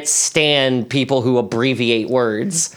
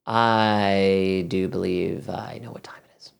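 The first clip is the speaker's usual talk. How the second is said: slow, soft, whispery build-up; hushed mock suspense; 5 semitones lower, 6 dB quieter, slower than usual. Co-host answering the segment cue, hushed mock suspense. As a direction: draw it out in a low, teasing hush.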